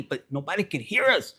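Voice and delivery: ordinary delivery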